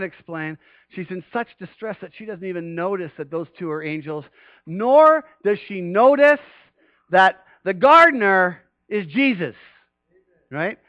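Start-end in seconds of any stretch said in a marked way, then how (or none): none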